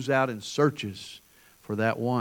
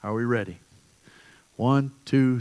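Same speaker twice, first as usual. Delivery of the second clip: ordinary talk, nothing marked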